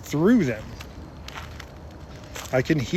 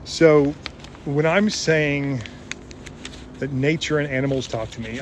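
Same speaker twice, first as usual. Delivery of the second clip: ordinary talk, nothing marked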